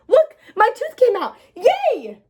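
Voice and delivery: high, loud gleeful cheer; excited child. Acted. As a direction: crow with delight over the tooth. Child shows off lost tooth.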